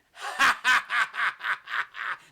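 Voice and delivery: loud, high staccato "ha" cackle; hammed-up villains' laugh; long burst of put-on cackling; mock evil laughter. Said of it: "They all start laughing like that".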